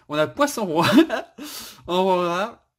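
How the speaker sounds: announcing loudly through laughter; laughing. Real, not acted. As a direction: break into laughter mid-announcement. Restarts announcement while laughing. (en riant aux éclats)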